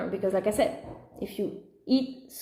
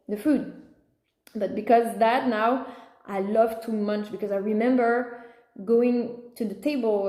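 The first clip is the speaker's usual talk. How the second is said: usual speaking voice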